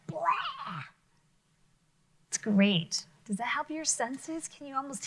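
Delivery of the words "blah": higher mock disgusted blah; monster retching blah. Teacher imitating the monster spitting out the toad. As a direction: make a gross spitting blah. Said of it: "He's like".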